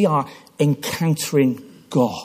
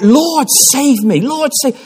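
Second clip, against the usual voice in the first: slow pleading cry to the Lord; children's desperate plea; 10 semitones higher, 4 dB louder, slower than usual. Retold or imitated. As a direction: beg the Lord to save you. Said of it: "crying out".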